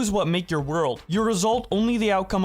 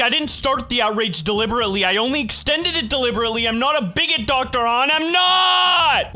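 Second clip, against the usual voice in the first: higher and louder, rising into a shout on I'm not; mock defensive rant; 7 semitones higher, 4 dB louder than usual. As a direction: play him as a flustered whiner getting shrill. Voicing Two Mad's defensive tweets.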